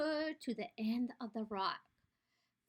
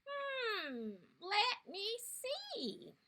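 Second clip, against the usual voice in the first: high, soft, slow musing; thinking Hmm; 7 semitones higher, 6 dB quieter, much slower than usual. Acted. Bandicoot considers the creature, softly musing. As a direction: ponder it out slowly.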